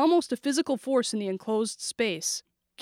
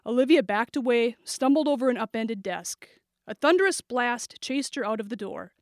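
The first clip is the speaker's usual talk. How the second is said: usual speaking voice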